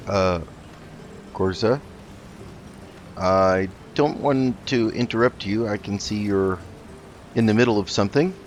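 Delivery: ordinary delivery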